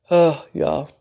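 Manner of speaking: normal talking voice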